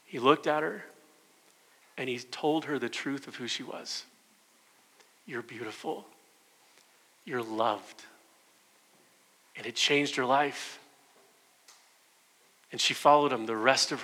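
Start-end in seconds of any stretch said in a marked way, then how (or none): 5.2-8.0 hushed, loving affirmation; soft and unhurried, let each phrase settle; tender reassurance. Acted. Jesus telling Mary the truth of who she was, tender and hushed.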